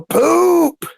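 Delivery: high shouted poop; man yelling from his room, high shout; yelled out. Retold or imitated. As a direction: yell it out high from the other room. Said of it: "he would yell out".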